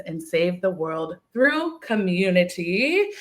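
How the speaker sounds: ordinary talk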